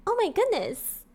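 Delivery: put-on high, innocent girl-next-door voice. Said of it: "She would say".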